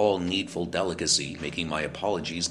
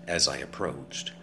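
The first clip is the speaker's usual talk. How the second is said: ordinary delivery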